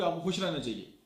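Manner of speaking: upbeat, convincing advice; happier tone. Shown as a demonstration. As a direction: say it as if you mean the happiness. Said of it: "मेरी बात ज़्यादा बेहतर पहुँचेगी".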